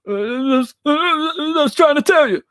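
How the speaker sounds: high, slurred, rambling boxing trainer impression; Roger Mayweather. Streamer does a requested Mayweather accent, high slurred impression. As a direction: babble it high and strained, words running together. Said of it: "that's Roger Mayweather. That's, that's my best".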